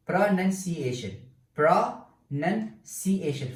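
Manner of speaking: "pronunciation" slowly divided into separate beats; syllable by syllable. Shown as a demonstration. Teacher reaching five syllables, "pronunciation" slowly counted out. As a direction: chop "pronunciation" into pro, nun, ci, a, tion. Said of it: "five syllables".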